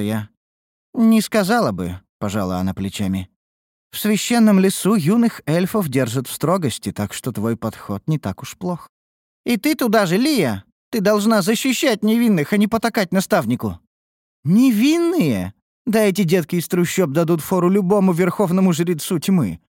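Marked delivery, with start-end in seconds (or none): 0.9-2.0 put-on lightly raised girl voice; a young woman answering, shrugging; girl's voice. A narrator's reading. 3.9-13.8 put-on raised, lightly scolding girl voice; a young elf chiding, half serious; girl's voice. A narrator's reading. 14.4-19.6 mock the idea of innocence; scoffing. A narrator's reading. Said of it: "хмыкнула высокая".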